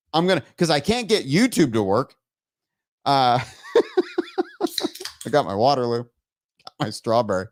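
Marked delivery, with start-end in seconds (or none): 3.7-5.2 high-pitched giggling fit; laughter. Real, not acted. Streamer amused by own chaotic show, laughing.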